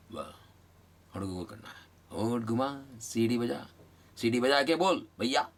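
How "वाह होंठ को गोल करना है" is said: ordinary talk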